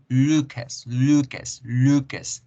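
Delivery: "Lucas" with a thick, rounded L; English pronunciation. Shown as a demonstration. Teacher modelling the English L, "Lucas" with thickened LU. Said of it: "El sonido se engruesa un poco porque la boca se redondea".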